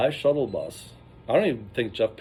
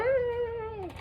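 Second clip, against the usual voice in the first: high falsetto whoop; 3 dB quieter than usual. Retold or imitated. Jeff on the cooler cart, high falsetto whoop. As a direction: let out a squealing whoop.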